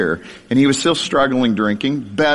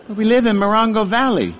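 put-on higher, childlike, with a laugh in it; little kid voice, laughing; 8 semitones higher than usual. Preacher repeats his child self's answer in a little voice. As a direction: replay the innocent kid line, amused. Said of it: "in my little voice".

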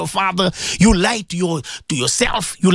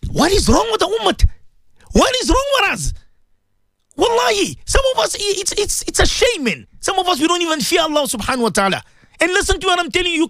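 impassioned rebuke; 9 semitones higher than usual. Real, not acted. Preacher's rising rebuke.

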